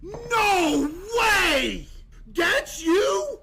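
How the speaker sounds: loud high astonished cry; shocked disbelief. Real, not acted. Streamer reacting to a friend's joke, shocked and loud. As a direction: shout it in total disbelief.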